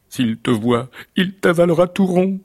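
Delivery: warning rising to high and loud; alarmed warning. Acted. Giant's wife warns the beggar about her husband, mounting alarm. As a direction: build to an alarmed cry.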